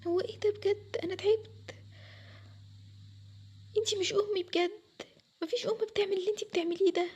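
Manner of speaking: high, softened, tearful-sounding complaint; wounded protest. Acted. Daughter telling mother she is no real mother, wounded. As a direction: sound worn out and deeply hurt.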